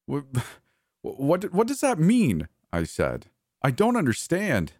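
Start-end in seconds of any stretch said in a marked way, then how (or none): 0.1-2.5 high, halting, alarmed question; much higher, disbelieving; bewildered stammer. A narrator's reading. Baffled by Justin's strange phrase. 3.6-4.7 distressed incomprehension; high, confused plea. A narrator's reading. Pleading confusion at the revelation.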